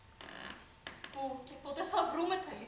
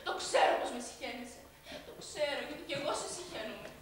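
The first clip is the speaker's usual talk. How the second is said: ordinary talk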